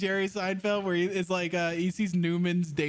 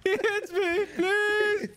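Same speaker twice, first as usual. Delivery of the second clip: very high, louder pleading whine; hammed-up girlfriend; 4 dB louder, much slower than usual. Friend mocking the teammate as a girlfriend, hammed-up whine. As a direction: ham up the damsel begging. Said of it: "I sound like Poop's girlfriend".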